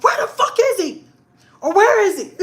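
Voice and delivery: loud, high-pitched angry demand; retold mad yelling. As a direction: act out hunting him down, shouting. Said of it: "I was so mad".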